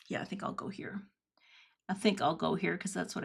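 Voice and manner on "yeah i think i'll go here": breathless whisper to herself, much softer; half to herself, unvoiced; whispered aside. Real, not acted. Crafter muttering her choice to herself, whispered.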